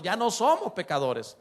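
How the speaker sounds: ordinary delivery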